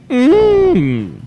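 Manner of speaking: a sudden high, loud "mm" squeak; weird noise. Real, not acted. Host on air making an odd sudden noise, loud high hum. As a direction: let a strange high hum slip out on air. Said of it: "That was a weird noise".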